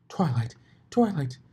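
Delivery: loud, rising calls; yelled. A narrator's reading. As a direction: yell for her friend. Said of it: "she yelled".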